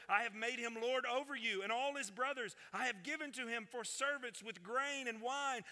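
high, intense Bible narration; dramatic scripture reading. A narrator's reading. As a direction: read the story with rising urgency.

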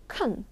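"can" said softly and short, like "kun"; quick reduced can. Shown as a demonstration. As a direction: toss off "can" with a weak vowel. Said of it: "빨리 발음할 땐". ("can"을 짧고 약하게 "큰"처럼)

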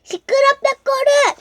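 put-on cutesy baby talk. Toy character voice.

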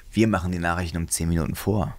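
low, soft radio-announcer voice; mock station announcer. Radio station bragging about early news, low announcer parody. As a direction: drop into a low, self-important station ident.

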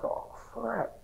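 soft, high-pitched mock dismay; quiet, sinking realization, re-enacted; retold dismay. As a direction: imitate a hushed reaction to bad news. Reacts to seeing his wound.

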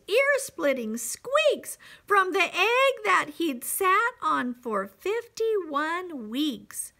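dramatic, high-energy storybook narration; story read to children. A narrator's reading. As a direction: build the commotion with punchy emphasis. Reads picture book to children.

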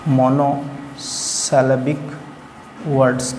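Monosyllabic stretched out slowly, each syllable mo-no-si-le-bik apart; slow model word. Shown as a demonstration. Teacher repeats the term for students, slowly and deliberately. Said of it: "monosyllabic word कहा जाता है".

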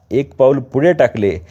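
usual speaking voice

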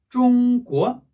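"쫑꾸어" with high held "쫑", then rising "꾸어"; first-second tone. Shown as a demonstration. Teacher joining the syllables, "쫑꾸어" slow, level then rising. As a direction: slowly hold "쫑" high, drop and lift "꾸어". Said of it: "이래 되고요".

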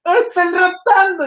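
put-on very high drunken yell; mock yell. Drunk passenger yelling at the driver, very high. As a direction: holler it slurred. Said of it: "imitating passenger".

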